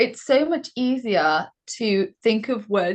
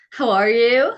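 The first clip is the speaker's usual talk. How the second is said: louder, put-on American accent; best American accent; 3 semitones higher, 5 dB louder than usual. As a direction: attempt an American "how are you", rising at the end. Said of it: "your best American accent".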